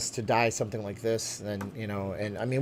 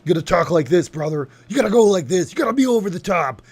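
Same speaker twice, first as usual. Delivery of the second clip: much higher, louder wrestler rasp; over the top wrestler; 6 semitones higher, 8 dB louder than usual. Shown as a demonstration. Streamer demoing a Hulk Hogan voice, over the top. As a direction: go big, over the top, like a pro wrestler promo. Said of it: "terrible impression".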